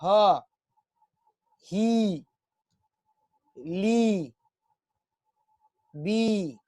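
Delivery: each syllable stretched with long pauses; drawn-out syllables. Shown as a demonstration. Teacher pronouncing syllables for students to echo. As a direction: say each sound alone so they repeat. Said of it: "आप वहां से अपने घर से pronounce करेंगे".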